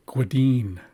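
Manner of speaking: "Gwadine" opening on a rounded "gw". Shown as a demonstration. Teacher reading an example word, "Gwadine" with rounded G. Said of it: "this is a rounded G, gwa".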